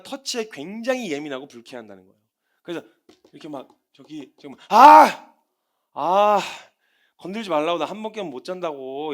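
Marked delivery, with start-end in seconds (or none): 4.7-9.1 annoyed shout at being woken; irritated groan. Retold or imitated. Sleeper yelling at the one waking him up, annoyed. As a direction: snap at whoever wakes you. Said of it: "이러면서 막 되게 예민하고 막 되게 짜증나게 반응하는".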